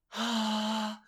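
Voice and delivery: breathy steps climbing in pitch; breathy stepped scale. Shown as a demonstration. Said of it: "Listen here".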